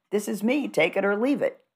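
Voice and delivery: high-pitched acted retort; take-it-or-leave-it. Voices a defiant upspeaker's reply.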